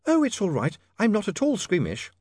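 high, breezy reassurance; cheerful young lady. A narrator's reading. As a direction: brush it off brightly.

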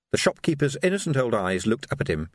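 ordinary delivery

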